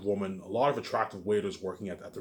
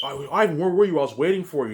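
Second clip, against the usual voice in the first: eager bartender; 6 semitones higher, 7 dB louder than usual. Retold or imitated. Bartender calling out the next day.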